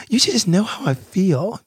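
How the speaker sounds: high mocking voice of a partner who expects mind-reading; mock upset partner. Man imitating women who expect men to read their minds, high mock voice. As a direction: parody her complaint in a high put-on voice.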